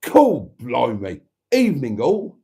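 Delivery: broad Cockney, loud punched first word; hammed-up Cockney impression, pitch leaping; Matron's voice. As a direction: do a big brash Cockney greeting. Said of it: "I should do the voice".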